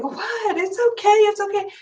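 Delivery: high, hushed mock hush-a-bye to a crying baby; imitating a soothing mother. Mother hushing her screaming baby, imitated high and soft. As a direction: coo it like a mother calming her child, pitched well up. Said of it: "And the mother is like".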